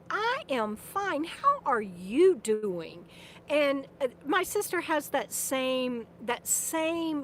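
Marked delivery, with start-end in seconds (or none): 0.1-3.0 slow, deliberate, lightly imitated old man; stepfather's slow reply. Quoting her stepfather answering the hospice nurses, slow and gentle. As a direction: draw each word out slowly and gently. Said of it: "And he'd go".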